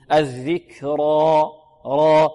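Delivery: الذكرى ending in a full, thick "raa"; heavy ra. Shown as a demonstration. Teacher demonstrates heavy ra, slow chant. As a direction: slowly chant الذكرى, thick r and long open a. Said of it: "الراء مفخمة".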